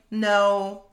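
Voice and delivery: a final, assertive no; firm no. Shown as a demonstration. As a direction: shut it down in one word.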